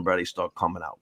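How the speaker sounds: normal talking voice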